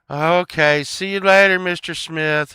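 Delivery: high, loud singsong goodbye; mock kid farewell. Kids seeing Mister Smith off to practice, mock kid farewell. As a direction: send him off like a chorus of kids. Friends' reply, acted as kids.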